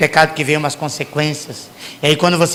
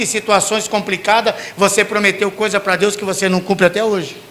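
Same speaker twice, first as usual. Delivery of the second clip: normal talking voice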